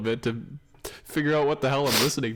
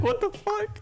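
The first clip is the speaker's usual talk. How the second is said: shocked; slower than usual. Retold or imitated. Hearing the noises on call.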